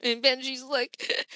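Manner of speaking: ordinary talk, nothing marked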